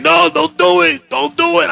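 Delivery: high, slow pleading warning; cop pleading. Retold or imitated. Fat cop begging him not to run, high and slow. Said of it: "He's like".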